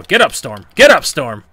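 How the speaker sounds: yelling urgently at the screen; shouting. Real, not acted. Gamer yelling at his wrestler Storm, shouting. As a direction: roar it, desperate.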